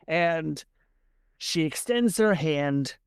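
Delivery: usual speaking voice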